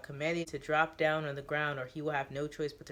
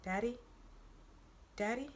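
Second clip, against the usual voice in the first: put-on higher, soft, questioning little girl; little girl's voice; 7 semitones higher, 7 dB quieter than usual. Neo mimics Teo's daughter, soft little girl voice. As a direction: call softly like a small daughter. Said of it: "soft-spoken little girl's voice".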